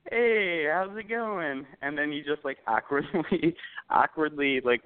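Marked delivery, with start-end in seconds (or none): 0.1-1.6 higher, drawn-out mock-friendly hello; uneasy retold hey; awkward greeting. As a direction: imitate someone covering shock with a greeting. Retold awkward greeting after recoil.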